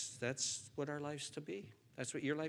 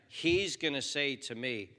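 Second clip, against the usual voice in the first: ordinary talk, nothing marked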